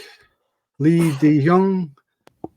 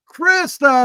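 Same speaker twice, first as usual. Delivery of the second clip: announcer call; 10 semitones higher, 5 dB louder, slower than usual. Acted. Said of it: "Say it with me, Crystal".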